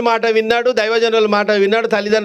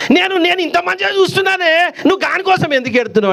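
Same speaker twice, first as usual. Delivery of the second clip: higher, hurt son protesting, speeding up; why cry for him, rushed and higher; elder son protesting to his mother, higher and fast; indignant son; 7 semitones higher than usual. Acted. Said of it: "వీడు అంటాడట".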